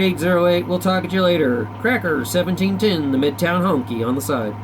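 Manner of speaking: ordinary talk, nothing marked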